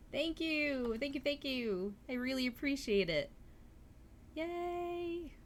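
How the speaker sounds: very high, loud delighted squealing; excited squeal. Real, not acted. Streamer thanking Leon for the sub, squealing with delight. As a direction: gush with thanks, thrilled. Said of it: "That makes me happy".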